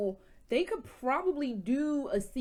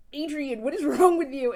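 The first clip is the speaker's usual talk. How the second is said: louder, higher voice of an alarmed husband; exasperated husband; 4 semitones higher, 8 dB louder than usual. Retold or imitated. Husband interrupted on the phone by crying wife, loud exasperation. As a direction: imitate a husband loudly demanding an answer. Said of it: "he's like".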